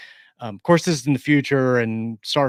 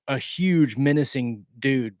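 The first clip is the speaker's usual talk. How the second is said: normal talking voice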